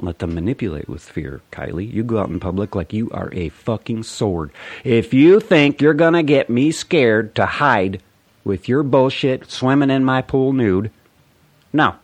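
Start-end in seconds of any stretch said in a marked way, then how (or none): 4.8-11.9 loud, high furious threat; angry threat. Real, not acted. Vlogger threatening a trespasser, loud and furious, high. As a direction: warn them off, getting louder.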